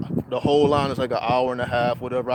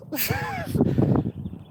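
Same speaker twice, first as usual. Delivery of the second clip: high laugh. Real, not acted. Laugh after story.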